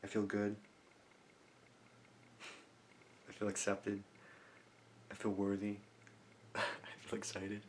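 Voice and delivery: hushed, inward affirmations with small laughs; soft affirmations. Shown as a demonstration. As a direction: softly savour each feeling, long pauses between. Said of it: "show you how I do the actual technique".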